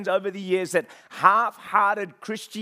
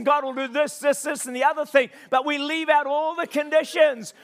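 impassioned, higher-pitched sermon delivery; full-force preaching; 6 semitones higher, 4 dB louder than usual. Real, not acted. Preacher urging the church to preach the whole gospel, full-force preaching. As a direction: preach it hard with driving, hammered phrases.

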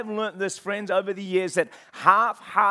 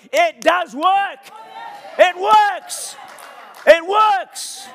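much louder, high, drawn-out preacher's shout; worked-up shouting; 10 semitones higher, 10 dB louder, much slower than usual. Real, not acted. Preacher insisting to the church that Christianity works, worked-up shout. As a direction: bellow each phrase slowly, hammering it home. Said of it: "I'm getting a bit worked up".

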